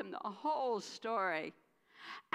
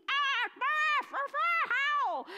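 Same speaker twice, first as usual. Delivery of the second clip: high, loud howling scream; mother's scream; 14 semitones higher, 9 dB louder, slower than usual. Acted. Mother shrieking on seeing her spotless son, loud high howl. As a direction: scream like she's seen a ghost. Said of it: "And she screamed".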